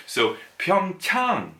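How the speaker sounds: slow careful model of "Pyeongchang"; model pronunciation. Shown as a demonstration. Teacher giving the correct Korean "Pyeongchang". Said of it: "that's how it's pronounced".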